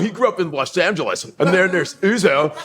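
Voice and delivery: high, hammed-up American dude accent; exaggerated all-American accent; overly proper American accent. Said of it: "All Nigerian kids speak proper".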